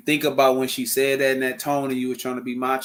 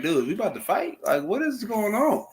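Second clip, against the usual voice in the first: mock alarmed partner; 9 semitones higher than usual.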